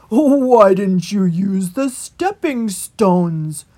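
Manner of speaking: put-on loud, slow amused old rat; chuckling old rat. A narrator's reading. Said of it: "chuckled the old water rat".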